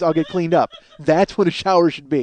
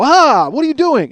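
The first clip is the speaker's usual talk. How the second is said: high, loud, slow startled cry; wife yelping at the hot water; 9 semitones higher, 7 dB louder than usual. Retold or imitated. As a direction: pull back as if scalded. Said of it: "she'll put her hand in and go".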